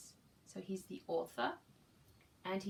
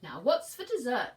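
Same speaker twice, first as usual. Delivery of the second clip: bear; 3 semitones higher, 11 dB louder than usual. Acted. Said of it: "said the bear".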